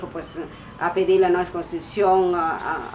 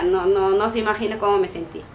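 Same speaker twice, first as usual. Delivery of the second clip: ordinary delivery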